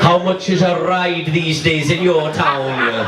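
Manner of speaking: high, laughing splutter; laughing banter. Real, not acted. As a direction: crack up mid-sentence. Comedian teasing heckler, laughing.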